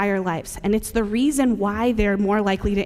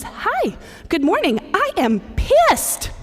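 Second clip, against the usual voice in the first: high, slow, sing-song report of being pissed; cheerful voice, angry words; imitating Sam; 9 semitones higher, slower than usual. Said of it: "And he goes".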